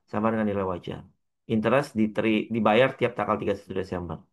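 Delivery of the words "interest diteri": ordinary delivery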